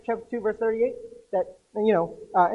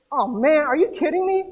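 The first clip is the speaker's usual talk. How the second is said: higher and louder, fed-up disbelief; exasperated complaint; 6 semitones higher, 5 dB louder than usual. Retold or imitated. Lazarus complains to Jesus on being raised, exasperated and much higher. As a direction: groan it like a rudely woken sleeper.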